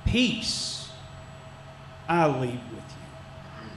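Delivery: slow, soft and heartfelt, Peace drawn out; mean every word of the blessing; sincere. Shown as a demonstration. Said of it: "his benediction there was sincere".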